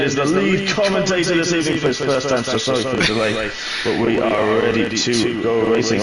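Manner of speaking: ordinary talk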